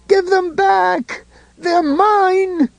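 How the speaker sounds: high, slow, pleading scream; desperate scream. A narrator's reading. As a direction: wail for what is yours. Ed screaming for the photo.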